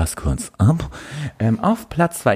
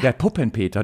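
usual speaking voice

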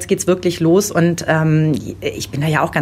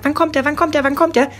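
put-on a higher, eager child's nagging; impatient child; 7 semitones higher than usual. Mother imitating her impatient son, higher. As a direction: mimic a little boy who can't wait. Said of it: "Felix hat den ganzen Tag gefragt".

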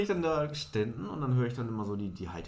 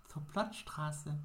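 lightly voiced timid stranger asking the way; a hint of a shy little woman; small woman asking; 3 semitones higher, 6 dB quieter, much slower than usual. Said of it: "small woman repeats".

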